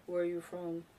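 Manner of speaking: low-pitched, softened quote; deep voice. Retold or imitated. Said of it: "imagine his deep voice".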